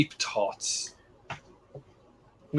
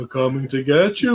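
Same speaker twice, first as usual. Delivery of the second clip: film-quote mimicry.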